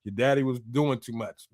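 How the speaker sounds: put-on the death angel coldly taking the boy. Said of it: "Death angel went right up in there like".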